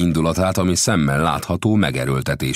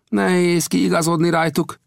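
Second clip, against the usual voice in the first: higher-pitched voice for the gambusino, earnest storyteller; gambusino's voice; 4 dB louder than usual. A narrator's reading. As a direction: tell the tale as the friendly gold digger. Gambusino answers.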